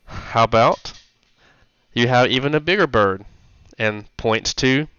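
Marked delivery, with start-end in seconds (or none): none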